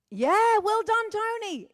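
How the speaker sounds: high, loud congratulating cheer; real excited praise. Real, not acted. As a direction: cheer a fan on. Cheering a fan.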